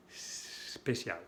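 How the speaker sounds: "Especial" with no E, starting on a long soft hiss; snake-like S. Shown as a demonstration. Teacher demonstrating the serpent sound, long hissed S opening "Especial". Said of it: "se pronuncia como la serpiente".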